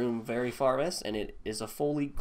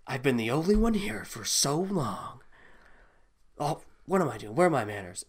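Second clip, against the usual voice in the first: lightly voiced flustered woman, a bit higher; a touch of fluster, louder than chat; flustered lonely woman; 3 semitones higher, 6 dB louder than usual. Game master voices a flustered woman.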